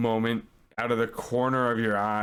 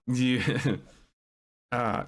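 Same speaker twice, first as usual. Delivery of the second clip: ordinary talk, nothing marked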